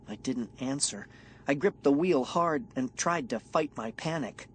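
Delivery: usual speaking voice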